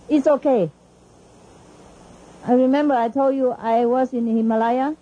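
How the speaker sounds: ordinary talk, nothing marked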